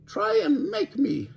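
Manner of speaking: high, slow taunting dare. A narrator's reading. Nightingale taunting Alligator, high slow dare. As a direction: goad her slowly.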